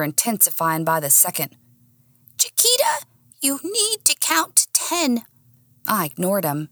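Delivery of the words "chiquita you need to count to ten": very high tiny dog voice; calm her down in a squeak; squeaky dog voice. Acted.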